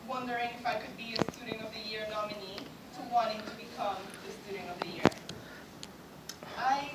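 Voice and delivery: usual speaking voice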